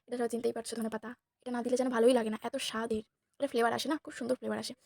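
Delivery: ordinary delivery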